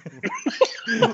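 sudden high laugh; high burst of laughter. Real, not acted. Laughing at the mangled name.

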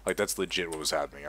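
normal talking voice

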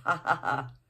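a forced, jarring ha ha ha; jarring laugh. A narrator's reading. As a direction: laugh a little too hard. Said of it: "slightly jarring laugh".

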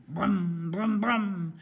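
hummed vroom noises, punched loud; imitated engine revving. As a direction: rev it like a rattly car. Imitates the car engine starting.

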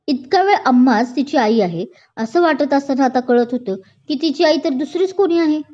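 ordinary delivery, nothing marked

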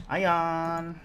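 high thrilled cry of iron; excited shout. Real, not acted. Gamer spotting iron, high excited shout. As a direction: shout the find with glee.

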